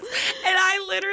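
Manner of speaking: high, cracking voice on the edge of tears; choked up. Real, not acted. Host still crying while finishing the Trader Joe's story. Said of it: "I didn't mean to get emotional".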